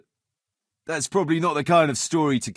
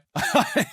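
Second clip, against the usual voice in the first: a bright rising laugh; a short amused laugh; 12 semitones higher than usual. Acted. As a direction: laugh along with the joke. Laughs at the drowning story.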